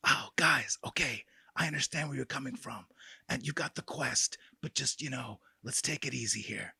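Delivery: very soft, take-it-easy hush; hungover whisper. Acted. Hungover character accepting the quest, whispering. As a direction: whisper gently through a hangover. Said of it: "He's got a hangover".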